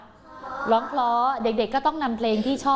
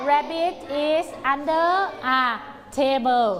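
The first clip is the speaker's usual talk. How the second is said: very slow, drawn-out model sentence; teacher reading the sentence slowly for the class; slow read-aloud; 4 semitones higher, much slower than usual. Shown as a demonstration. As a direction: read it out word by word. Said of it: "ประโยคนี้ต้องอ่านว่าอะไรคะ".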